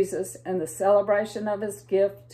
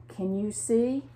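ordinary delivery, nothing marked

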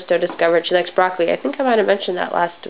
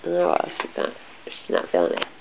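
hushed whispered aside; whispering; 3 semitones lower, 9 dB quieter than usual. Real, not acted. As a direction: whisper it under your breath. Whispered aside about baby.

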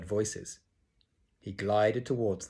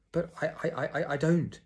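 much higher, stammering repeats; stuttering; 7 semitones higher than usual. A narrator's reading. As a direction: stammer out a denial. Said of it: "he was stuttering worse than ever".

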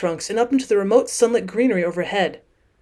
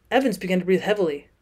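plain reading voice

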